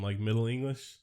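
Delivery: usual speaking voice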